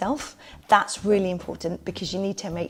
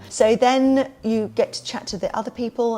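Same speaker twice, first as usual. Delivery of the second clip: ordinary talk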